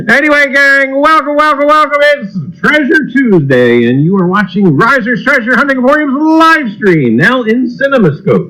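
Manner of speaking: high, beaming announcer welcome; showman host intro. Acted. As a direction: greet the gang like a carnival barker. Host opens the livestream.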